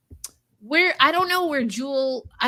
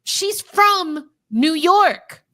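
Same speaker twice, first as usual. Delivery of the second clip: each word drawn out, slow and louder; slow emphatic declaration; 4 semitones higher, 6 dB louder, much slower than usual. Real, not acted. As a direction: lay down the law, word by word. Insisting on her point.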